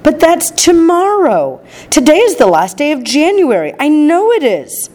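a mother crying out in sudden excitement; excited cry. A narrator's reading. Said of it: "cried Missus Bucket".

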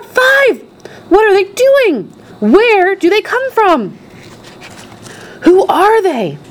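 high, astonished cries from a crowd; excited shouting. A narrator's reading. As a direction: exclaim one after another in amazement. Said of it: "everybody started shouting at once".

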